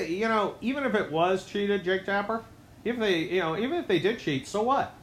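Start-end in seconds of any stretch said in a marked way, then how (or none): none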